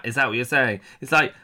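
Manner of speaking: normal talking voice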